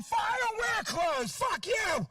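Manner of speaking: high shouted mockery of a naked protester; mock naked guy yell. Comic mocking a naked anti-clothes guy, mock yell. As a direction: yell it like an outraged naked man. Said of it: "a naked guy who's going".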